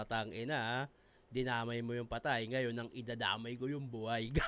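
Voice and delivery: low, quiet inner monologue; lightly voiced, as if thinking to oneself; Abra's imagined thought. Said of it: "sa isip-isip siguro ni Abra". (mahinang pabulong-isip, mababa ang boses)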